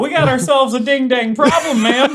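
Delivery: hammy country lawman; player's character confronting the woman, laughing; folksy Minnesotan. Acted.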